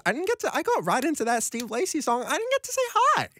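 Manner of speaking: high, fast, giddy rush of words; excited squeal. Real, not acted. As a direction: gush at the listeners. Excited host greeting listeners.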